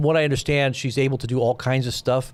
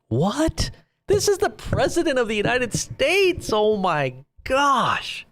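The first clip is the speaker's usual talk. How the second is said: much higher voice, stunned disbelief; incredulous outrage; 11 semitones higher, slower than usual. Real, not acted. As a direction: gasp it out as if you can't believe it. Reacting to president's post.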